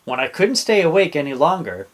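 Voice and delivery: plain reading voice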